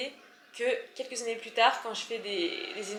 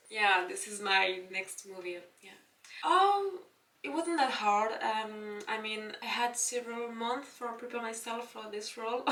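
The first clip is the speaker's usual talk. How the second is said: interviewed actress; 4 dB quieter, slower than usual. Pretend. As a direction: pretend star discussing her film. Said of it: "comme si je parlais à la présentatrice".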